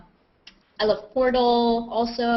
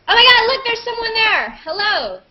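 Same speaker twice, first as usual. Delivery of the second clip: startled, calling out to the newcomer; sudden surprise; 12 semitones higher, 4 dB louder than usual. Real, not acted. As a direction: pitch jumps high and loud at someone appearing. Someone unexpectedly joins the hangout.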